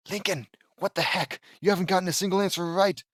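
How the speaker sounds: usual speaking voice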